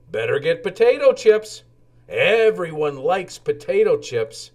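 talking a friend into treats; eager. Acted. Lowly suggests chips.

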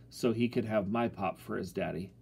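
ordinary delivery, nothing marked

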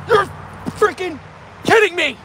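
can't believe it; shocked disbelief. Real, not acted. Telling viewers about losing the fish, in shock.

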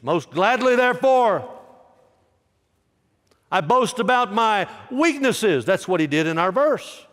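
high, loud preached reading; fervent scripture reading. Acted. Preacher reading Paul's boast to the church, high and loud. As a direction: proclaim Paul's words from the pulpit.

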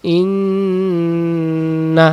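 ইন্না with the nn held very long; ইন্না drawn out with a long nasal hold. Shown as a demonstration. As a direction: hum the nasal in ইন্না slowly. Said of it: "নুন সাকিনে বাম পাশে তাসদিদ ওয়ালা অক্ষর".

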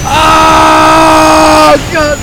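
loud high panicked yell; panicked shout. Real, not acted. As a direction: scream it in panic.

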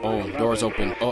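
ordinary talk

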